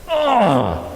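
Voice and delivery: high falling "ugh"; drawn-out acted groan of exasperation; exasperated groan. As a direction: groan in mock frustration at broken technology. Exasperation when technology doesn't work.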